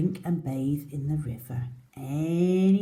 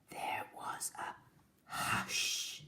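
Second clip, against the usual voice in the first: breathy whisper, very soft; hushed whisper; 12 dB quieter than usual. A narrator's reading. Narrator hushes the listening children, whispering. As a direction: let the forest fall silent.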